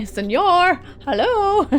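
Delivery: high, laughing sing-out hello; calling out. Real, not acted. Game master hailing a missing player, calling out. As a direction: call to someone who may not hear.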